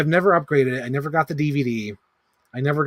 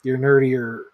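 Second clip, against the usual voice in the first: normal talking voice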